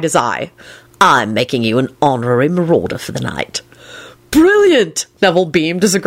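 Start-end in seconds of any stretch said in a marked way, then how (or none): 1.0-3.6 low, lightly voiced delighted tutor; Lupin, amused. A narrator's reading. 4.3-5.1 high elated cry. A narrator's reading. Neville elated.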